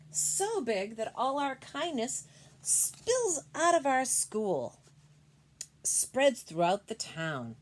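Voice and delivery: read-aloud voice, growing grand; reading to children. Acted. Reads book text aloud.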